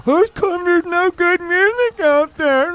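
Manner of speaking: high, slow whiny complaint. Retold or imitated. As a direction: whine it like a complainer. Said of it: "I love when you do your whiny voice".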